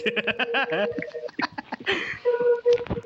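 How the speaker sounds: squealing high laugh. Real, not acted. Laughs at scary entity.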